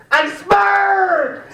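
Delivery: high, slow drawn-out shout; hammed-up drunk yell of his nickname; drunken shout. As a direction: bellow the name.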